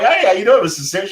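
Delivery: excited greeting. Retold or imitated. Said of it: "And I'm like".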